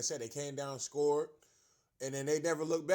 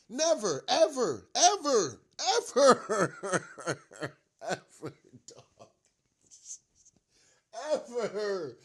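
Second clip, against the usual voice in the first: each ever louder and higher, then trailing off into a laugh; building yell; 5 semitones higher, 8 dB louder, slower than usual. Real, not acted. Fan ranting at the head coach, building to a shout. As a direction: hammer the word ever, climbing to a shout. Escalating repeated ever rant.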